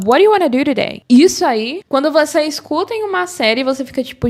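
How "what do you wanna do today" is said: "What do you wanna do today" blurred into "wari-wanna-du-riday"; linked-up American "What do you wanna do today"; connected native speech. Shown as a demonstration. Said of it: "Ou senão ele fala".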